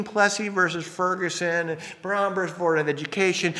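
mock lawyer rattling off citations; dry legalese. Parodying a Supreme Court briefing, dry legalese. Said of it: "They are the most dry, legalese, full of mumbo jumbo".

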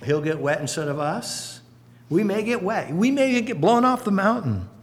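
normal talking voice

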